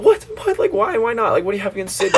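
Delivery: high-pitched imitation of baffled girls; exaggerated, high incredulous questioning; mock bafflement. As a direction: squeal the questions in mock disbelief. Retelling girls' baffled reaction.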